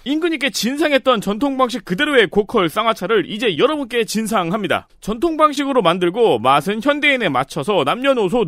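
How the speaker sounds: infomercial. Shown as a demonstration. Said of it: "저는 이제 톤으로 알려드리는 거죠".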